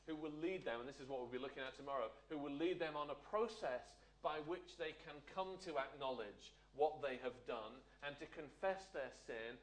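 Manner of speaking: ordinary talk, nothing marked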